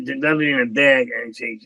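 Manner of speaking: ordinary delivery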